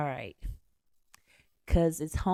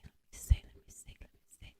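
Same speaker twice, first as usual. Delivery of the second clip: breathy whisper to himself; 6 dB quieter, faster than usual. Real, not acted. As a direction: whisper it while reading. Reading lyric slip to himself.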